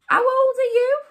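high, loud burst of surprise; startled monster's high-pitched question; astonished gasp. A narrator's reading. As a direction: ask it in amazed disbelief. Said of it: "the monster gasped".

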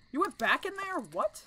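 very high surprised question; shocked disbelief. Real, not acted. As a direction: jump up in pitch, astonished. Surprised by character's move.

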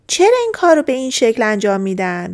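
ordinary delivery

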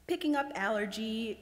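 ordinary delivery, nothing marked

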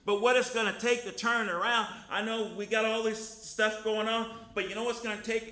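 loud, rising sermon voice, lines hammered out; full-force preaching. Acted. Preacher urging the congregation to love, full-force preaching. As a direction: preach it hard to the church.

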